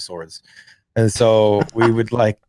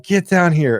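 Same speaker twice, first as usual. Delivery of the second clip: angry mom; 7 semitones higher, 4 dB louder than usual. Retold or imitated. Mom voiced in story.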